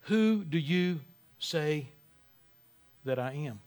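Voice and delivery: drawn-out quiet challenge; slow, hushed question. Shown as a demonstration. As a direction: let the question hang, quietly. Repeats the question, slowed.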